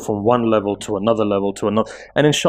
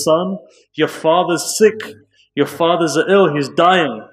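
grieving mother; 6 semitones higher, slower than usual. Acted. Mother tells child father dying.

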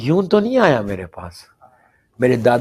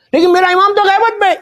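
anguished plea; 6 dB louder, faster than usual. Acted. Pleading to Imam Ali.

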